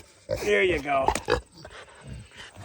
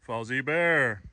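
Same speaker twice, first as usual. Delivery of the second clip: normal talking voice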